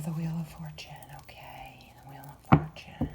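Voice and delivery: breathless whispered reveal; whispered surprise over the card; awed whisper. Real, not acted. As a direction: hush it out in awe at the card.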